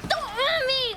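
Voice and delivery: high frantic cry for mercy; panicked plea. Real, not acted. Player under attack crying out in panic. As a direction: beg in a burst of panic.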